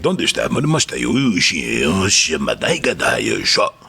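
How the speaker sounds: slow mock-Japanese movie dialogue; old Japanese film voice, stretched. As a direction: rattle off pretend Japanese, drawn out at the end. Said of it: "the old Japanese movies".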